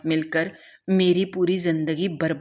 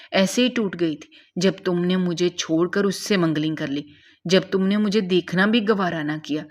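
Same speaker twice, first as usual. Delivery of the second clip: ordinary delivery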